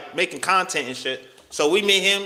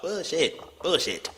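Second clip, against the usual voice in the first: muttered scoff; 7 dB quieter, slower than usual. Real, not acted. Scoffing at her excuse.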